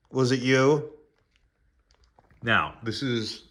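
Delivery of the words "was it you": higher, louder accusing repeat; stern loud question. Real, not acted. Owner grilling the dog, louder accusing question. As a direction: demand it again, pressing hard.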